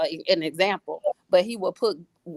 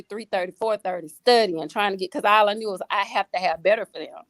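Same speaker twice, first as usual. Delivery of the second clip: ordinary talk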